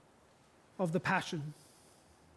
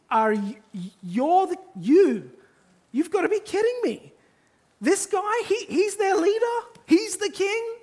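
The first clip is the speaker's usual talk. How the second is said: much higher, louder, stammering disbelief; incredulous Pilate; 9 semitones higher, 5 dB louder than usual. Acted. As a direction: scoff as if this can't possibly be the king. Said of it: "the way Pilate's asking this question is".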